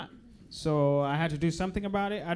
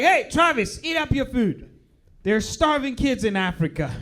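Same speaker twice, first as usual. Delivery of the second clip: loud scolding parent nagging about food; 5 semitones higher, 5 dB louder than usual. Retold or imitated. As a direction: nag the kid to finish dinner. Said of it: "American parents were like".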